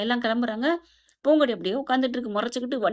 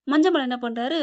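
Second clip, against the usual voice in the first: ordinary delivery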